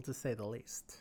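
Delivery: breathy whisper, dropped way down; whispered aside. Real, not acted. Podcast host whispering a dry jab to co-host.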